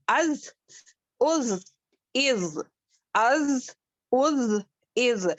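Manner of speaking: از said with breath flowing out on the z. Shown as a demonstration. Teacher showing breath escaping on zal. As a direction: let the z breathe out. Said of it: "ذال میں بھی ہماری سانس جاتی ہے".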